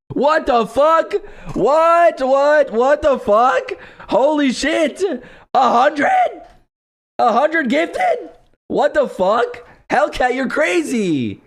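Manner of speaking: stunned, shouting high and slow; can't believe it, yell it out; shocked yelling. Real, not acted. Shocked by hundred gifted subs.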